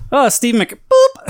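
high, loud "boop" cutting off the name; comic hang-up with a punched, squeaky boop; acted-out hang-up. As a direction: act out abruptly hanging up mid-name.

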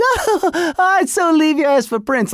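high, giddy mock girlish squeal; goofy broad. Goofy fan swooning over [name], high giddy mock voice. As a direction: play a silly swooning fan. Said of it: "goofy broad who's like".

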